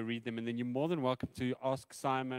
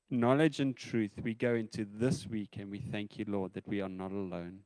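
closing prayer; 5 dB louder than usual. Acted. Pastor closes in prayer.

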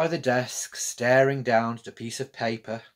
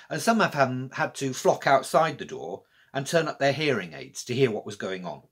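usual speaking voice

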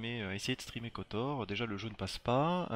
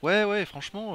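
excited; 10 semitones higher, 10 dB louder than usual. Real, not acted. Admiring the viewers' repaints.

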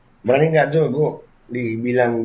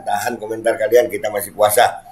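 usual speaking voice

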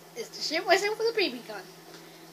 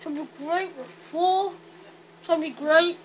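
cheeks stuffed full; slower than usual. Real, not acted. More grapes stuffed in.

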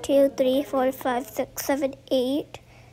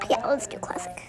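hushed under the breath; whispered; 7 semitones lower, 4 dB quieter than usual. Real, not acted. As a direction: whisper it to yourself. Repeats choice under breath.

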